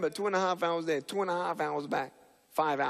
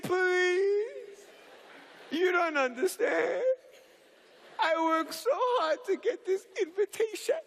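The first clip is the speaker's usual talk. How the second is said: pleading. Retold or imitated. Begging brother for a ride.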